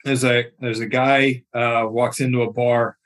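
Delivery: usual speaking voice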